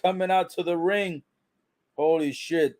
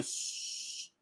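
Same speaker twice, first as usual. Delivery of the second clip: imitated shush; 19 dB quieter than usual. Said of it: "going like this".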